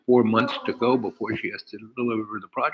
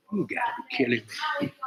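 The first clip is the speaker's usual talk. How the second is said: scoffing disbelief; 11 semitones higher, 5 dB quieter, faster than usual. Retold or imitated. Speaker scoffing at offer.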